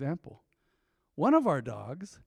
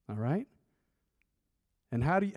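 usual speaking voice

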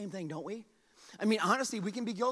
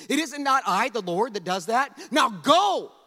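loud, higher rebuke; God getting mad; 4 semitones higher, 9 dB louder than usual. Acted. God loses patience with Moses, mounting anger. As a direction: build to a thundered command. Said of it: "You can almost hear God getting mad".

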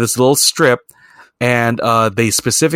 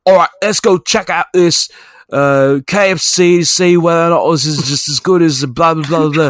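higher-pitched mock video-host patter; YouTuber impression; 5 semitones higher than usual. YouTuber introducing a KFC taste test in Japan, mock host voice. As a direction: imitate a YouTuber, trailing into blahs. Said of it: "guy who did a YouTube video".

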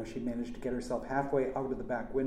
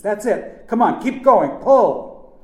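high, very loud cheering-on; shouted urging; 10 semitones higher, 17 dB louder, slower than usual. A narrator's reading. Uncle urging nephew on, shouting. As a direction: yell to keep them pulling.

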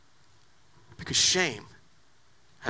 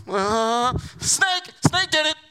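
Eve blaming the snake; 3 semitones higher, slower than usual. Acted. Eve answering God, sheepishly blaming the snake. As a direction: stammer it out, pointing at the snake.

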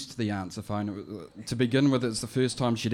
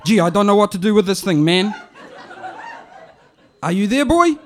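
higher, louder, fast and laughing; flustered auntie on the phone; 10 semitones higher, 13 dB louder than usual. Retold or imitated. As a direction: auntie baffled by the machine. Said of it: "it goes like this".

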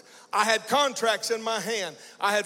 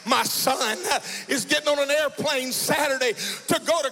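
louder, ringing over the music; full-force preaching; 5 semitones higher, 3 dB louder than usual. Acted. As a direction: shout the good news to the congregation.

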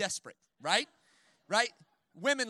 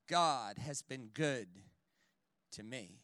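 quiet conviction; 9 semitones lower, 4 dB quieter, slower than usual. Acted. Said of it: "preacher repeats conviction".

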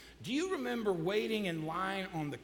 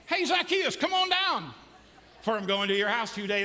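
loud, pointing; 2 semitones higher, 5 dB louder than usual. Shown as a demonstration. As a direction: call him down with a pointed finger. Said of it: "get your finger ready".

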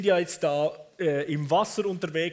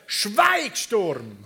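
raised-voice command, dropping on the last word; loud command; 4 dB louder than usual. Acted. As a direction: call out sternly to silence a storm. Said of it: "befahl in einer lauten Stimme".

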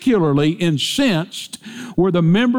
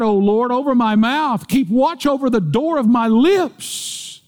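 higher, fervent pulpit voice; preaching; 8 semitones higher than usual. Acted. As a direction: lift the congregation with the verse. Preaching at rising force.

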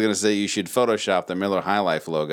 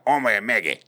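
mumbled Southern; 3 semitones higher than usual. Retold or imitated. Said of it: "the guy who's just indiscernible".

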